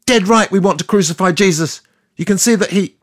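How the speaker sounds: high, loud yell from the crowd. Retold or imitated. Narrator in the crowd yelling to Pilate, loud yell. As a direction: shout it out over the crowd. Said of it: "I yelled".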